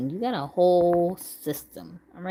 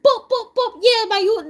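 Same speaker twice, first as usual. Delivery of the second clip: loud, high mock Jamaican patois; Jamaican accent; 14 semitones higher, 7 dB louder than usual. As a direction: burst into hammed-up Jamaican talk. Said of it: "That's the Jamaican right there".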